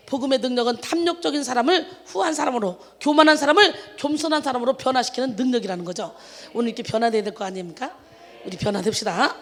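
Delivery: ordinary talk, nothing marked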